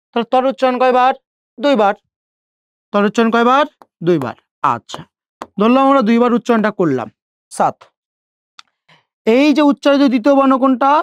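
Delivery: ordinary talk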